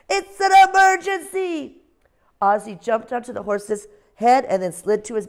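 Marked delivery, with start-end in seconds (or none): 0.1-1.8 much higher, louder owl voice crying out; a tiny owl pretending there's an emergency; little owl's alarm. Ozzie's voice in story.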